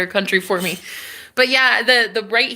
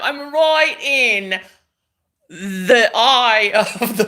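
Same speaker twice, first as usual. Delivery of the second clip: loud, high, hammed-up British accent with laughs; 6 semitones higher, 5 dB louder than usual. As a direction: belt it out in a mock British accent, amused. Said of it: "when you do the British accent".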